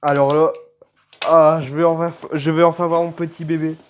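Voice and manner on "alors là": ordinary delivery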